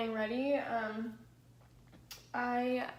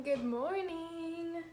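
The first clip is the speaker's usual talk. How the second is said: slow, higher, stretched good morning; drawn-out singsong greeting; 6 semitones higher, much slower than usual. Real, not acted. Vlogger greeting her livestream viewers, slow and higher. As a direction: sing the greeting out slowly and higher.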